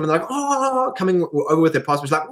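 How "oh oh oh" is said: much higher startled little cries; flustered oh. Retold or imitated. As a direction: caught off guard and scrambling. Flustered travellers answering officer.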